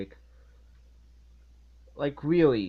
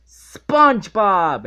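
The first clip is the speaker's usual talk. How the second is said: loud, slow, higher shout of the name; yelled name; 7 semitones higher, 12 dB louder, slower than usual. Retold or imitated. Mr. Krabs yelling for SpongeBob, loud and drawn out. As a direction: bellow his name across the restaurant.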